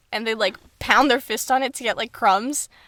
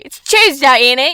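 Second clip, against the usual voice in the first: mock British accent; 3 semitones higher, 9 dB louder than usual. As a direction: toss it off in a put-on British accent. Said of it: "you can say".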